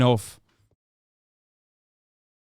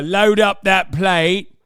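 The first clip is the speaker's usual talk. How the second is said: slow, higher, louder British geezer voice; mock British accent; 5 semitones higher, 7 dB louder, much slower than usual. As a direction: relish the food like a Brexit lad. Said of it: "Terrible British accent".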